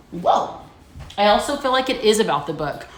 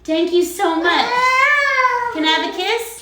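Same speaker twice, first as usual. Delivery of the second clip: gushing thanks; 12 semitones higher, 3 dB louder than usual. Real, not acted. Mom thanking her daughter and asking a kiss, high cooing. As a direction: coo your thanks to a little one.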